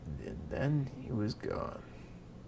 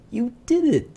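loud, much higher astonished cry; amazed girl; 11 semitones higher, 11 dB louder than usual. Acted. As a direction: burst out in disbelief.

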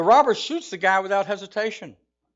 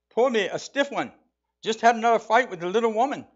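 higher, quick bad Irish accent for a weary husband; 5 semitones higher than usual. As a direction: play Shamus in a hammy higher Irish brogue, rushing. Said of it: "to learn a bad Irish accent".

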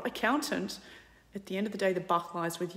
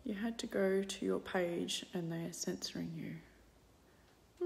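usual speaking voice

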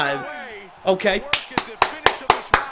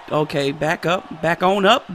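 ordinary talk

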